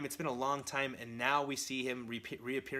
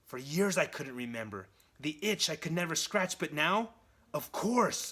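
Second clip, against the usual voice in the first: raised, loud, building to triumph; dawning realisation; 5 semitones higher, 5 dB louder than usual. Acted. Norman finally remembering what he could not, excited realisation. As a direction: let the revelation build to a punched finish. Villain's memory suddenly comes back.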